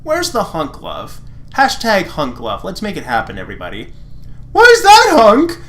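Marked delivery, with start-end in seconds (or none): 4.5-5.6 very high, loud indignant question; annoyed Lance. Acted. Lance challenging Hunk, very high and loud. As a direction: shoot it up high, offended.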